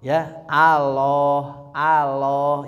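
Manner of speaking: "Allah" with thin lam and pouted lips; "Allah" with lips pushed out, lam light; rounded-lip error. Shown as a demonstration. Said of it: "bibir dimonyongkeun".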